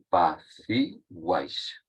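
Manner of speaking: apaciguáis drawn out slowly, stress on the a of -guáis; slow model word. Shown as a demonstration. Teacher repeats apaciguáis slowly to show the triphthong. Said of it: "Averiguáis".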